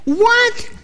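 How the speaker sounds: high, startled yelp of a question; acted incredulous outburst; shocked disbelief. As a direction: blurt it in disbelief. Man is shocked by the command.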